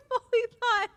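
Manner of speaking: high, squeaky words through helpless laughter; laughing. Real, not acted. Streamer explaining the scare, laughing helplessly. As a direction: try to speak but crack up. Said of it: "Oh my God, I'm crying".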